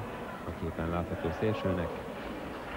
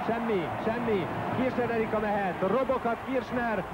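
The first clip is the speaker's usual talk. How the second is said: much higher, breathless live call; excited hungarian sports commentary; 9 semitones higher than usual. Real, not acted. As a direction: call the attack at the top of your voice. Fast attack, save, injury worry.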